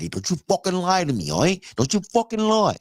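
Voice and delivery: hammed-up Italian mobster warning the son; a hint of Italian mob accent. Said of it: "treat him like a fucking Italian".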